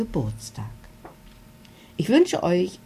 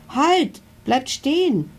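pleading stop; 9 semitones higher, slower than usual. A narrator's reading. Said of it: "bittet Milo".

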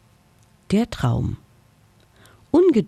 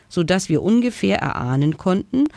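ordinary delivery, nothing marked

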